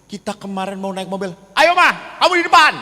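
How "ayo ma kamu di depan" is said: higher, louder bossy call; loud blunt order. Retold or imitated. Batak husband orders wife into the front seat, loud and blunt. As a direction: bark it at the wife across the car. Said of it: "Kok gitu ya, Romo? Harusnya kan".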